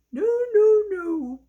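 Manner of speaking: a little dog chirping its refusal; doggy refusing. Acted.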